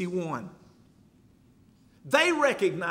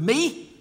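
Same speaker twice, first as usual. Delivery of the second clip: "me" lifted higher and louder; stress on me; 6 semitones higher, 5 dB louder than usual. Shown as a demonstration. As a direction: lean hard on "me". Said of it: "Did Jesus emphasize the word".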